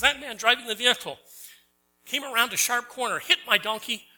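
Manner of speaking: a farmer accusing the driver in court; accusing witness. Retold or imitated. Preacher voicing the farmer accusing the driver in court.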